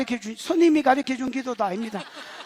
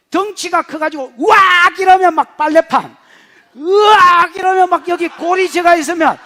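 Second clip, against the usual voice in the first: loud, higher hammed-up bellow; mock brute roar; 4 semitones higher, 9 dB louder than usual. Preacher mocking a muscle-bound Samson, loud and hammed-up. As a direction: roar it like a show-off strongman.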